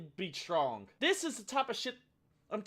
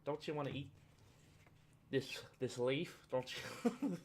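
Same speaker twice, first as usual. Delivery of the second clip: soft, lower, coaxing voice; sweetly pester, a soft mock plea; mock pushy vegan; 4 semitones lower, 7 dB quieter than usual. Vegan pestering him over fried chicken, soft mock coax. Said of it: "was like".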